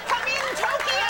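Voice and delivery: high, slow radio-operator call; mock radio call. Guest teasing the host's gesture, mock radio call. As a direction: call out high and drawn out, as if raising a distant station.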